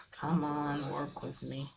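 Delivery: soft, low self-talk; real hushed aside while searching; muttered impatience. Real, not acted. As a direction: coax it quietly under your breath. Coaxing while looking something up.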